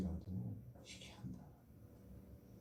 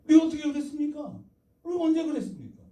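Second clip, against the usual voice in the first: high, loud protesting questions; 11 semitones higher, 7 dB louder, faster than usual. Acted. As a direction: protest the accusation as the people of Israel. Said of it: "이스라엘이 항변해요". (높고 큰 소리로 따지듯 항변)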